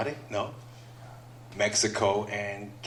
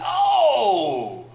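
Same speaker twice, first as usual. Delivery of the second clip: loud, high startled gasp of a word; a burst of fake shock; mock startled oh; 9 semitones higher, 6 dB louder than usual. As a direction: play it shocked and louder. His mock startled reply.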